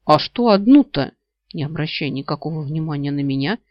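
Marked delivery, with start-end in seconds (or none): 0.0-1.1 higher, loud indignant protest; angry grumble. A narrator's reading. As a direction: demand it with mild annoyance. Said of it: "сердито пробурчал он".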